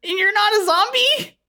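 put-on high-pitched, incredulous kid voice; bewildered teenager. Tyler questions the woman who bit his sister, incredulous. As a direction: squeak your disbelief.

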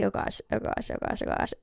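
whispered anxious chant; soft whispered panic before the drive; nervous whisper. Retold or imitated. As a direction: breathe it out nervously.

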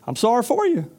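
the chicken shrugging off the mouse's trouble; uncaring chicken. Acted.

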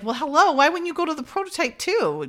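high-pitched sarcastic questioning; mock incredulity. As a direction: point out the obvious, exasperated in play. Mocking an obvious oversight.

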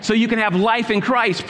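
rising, forceful preacher's call; preaching. Acted. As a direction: drive each warning home to the crowd. Pastor preaching to congregation.

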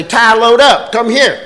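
loud call to a dog. Retold or imitated. Calling the dog.

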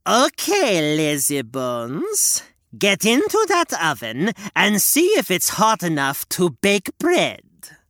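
slow, drawn-out hag demand; demanding witch. Acted. Witch tricks Gretel at the oven, drawn-out hag. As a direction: lure her into the oven. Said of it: "the witch demanded".

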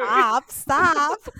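loud, embarrassed stop, stop, half laughing; playful protest. Real, not acted. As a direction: wave off the compliment loudly. Flustered by compliment, laughing protest.

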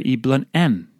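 usual speaking voice

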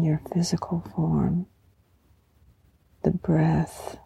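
breathy guided meditation, hushed and calm; warm serene goddess voice. Acted.

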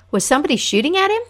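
sudden high gasping alarm; gasp, then blurt it out fast; startled gasp. Acted.

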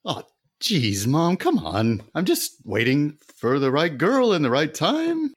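high, loud whiny son protest; exasperated son. Acted. Son defends missing his mother's birthday, high and exasperated. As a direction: protest like a scolded grown son.

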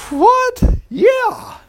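very high squeaky disbelief; squeaky shocked what. Real, not acted. Preacher reacting to Israel growing fat, high and shocked. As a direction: shoot the pitch up in shock.

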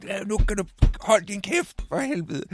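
high, mock angry yell at his friend; retold yelling. Miles yelling at Jack on the golf course, mock angry. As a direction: bark it out like a furious golfer. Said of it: "og råber og skriger".

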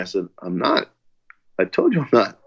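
normal talking voice

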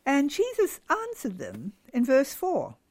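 normal talking voice

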